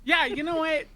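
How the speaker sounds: loud and high; too loud for indoors. Acted. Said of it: "inside voice".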